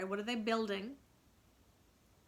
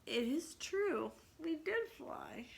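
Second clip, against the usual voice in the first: sleepy mutter; 7 semitones higher, 3 dB quieter, slower than usual. A narrator's reading. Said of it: "rub his eyes and mutter".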